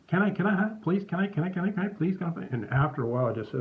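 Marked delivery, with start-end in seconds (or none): 0.1-2.5 put-on high, pleading kid voice; a boy pestering to walk the dog, high and pleading; eager little kid. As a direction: beg like an eager puppy. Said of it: "he was like a little dog himself".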